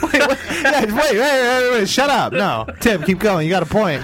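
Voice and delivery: egging a friend on while cracking up; laughing excitedly. Real, not acted. Cracking up, urging Tim on.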